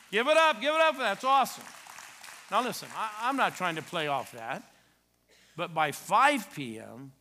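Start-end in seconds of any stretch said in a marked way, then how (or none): 0.1-1.6 high, loud call to the crowd; shouted cheer. Real, not acted. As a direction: rouse the congregation to applaud. Urging congregation to cheer.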